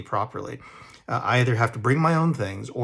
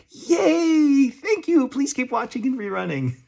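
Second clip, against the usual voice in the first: high mock cheer breaking into a laugh; half-laughing fake excitement, much higher; ironic cheer; 13 semitones higher, slower than usual. Real, not acted. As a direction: cheer sarcastically, laughing at your own tiny paycheck. Ironic cheer over seventeen cents.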